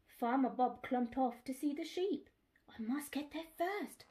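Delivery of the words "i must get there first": breathy hushed whisper; secret whisper. Acted. Dolly plotting secretly.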